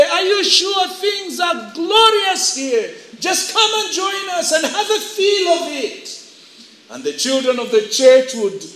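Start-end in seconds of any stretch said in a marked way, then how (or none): 0.0-6.1 mock yelling back. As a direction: call across temptingly in a high voice. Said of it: "the world would yell back and say".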